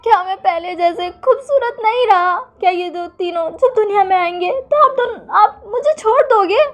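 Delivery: high, wailing tearful plea; loud crying. Acted. Wing to his husband, wailing that he is called fat, high tearful wail. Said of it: "ज़ोर-ज़ोर से रोने लगता है".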